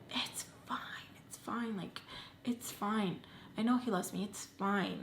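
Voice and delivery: softly whispering denial to herself; whispered self-soothing. Retold or imitated. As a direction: whisper it to yourself like a mantra.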